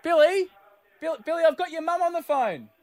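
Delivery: high-pitched mock call to another room; calling out to child. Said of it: "Okay, I'll just go".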